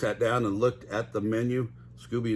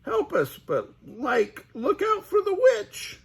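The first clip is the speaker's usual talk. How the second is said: high slow scared Shaggy; high frightened Shaggy; Shaggy pleading; 3 dB louder, slower than usual. Acted. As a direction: beg for help and warn. Shaggy warns of witch.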